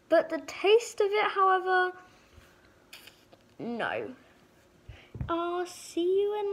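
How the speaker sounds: talking with a bubble mask on; speaking through a mask mouth hole; wearing a face sheet mask. Real, not acted. Seen 4: Sheet mask covers whole face, mouth opening, throughout; refs show no mask.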